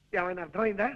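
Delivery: suddenly low and soft after calling out; low, subdued question; dropped, quiet voice. Acted. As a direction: drop right down to check she understood. Exhausted check after shouting the news.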